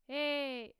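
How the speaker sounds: loud, held, piercing call; belted twangy shout on one vowel; edge with twang. Shown as a demonstration. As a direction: squeeze the back and ring it out. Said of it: "really squeezed as if I'm talking with a lot of twang".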